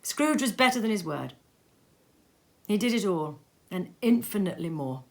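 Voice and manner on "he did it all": ordinary delivery, nothing marked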